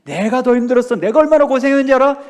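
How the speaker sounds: hammed-up complaining church member; self-centered boast. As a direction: put your own suffering first.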